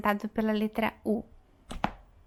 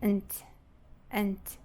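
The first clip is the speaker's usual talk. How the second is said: "ant" short and weak as ənt; brief reduced "ant", the end of present; unstressed syllable; 2 semitones lower, 3 dB quieter than usual. Shown as a demonstration. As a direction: let "ant" fall away with a schwa. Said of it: "a sílaba átona".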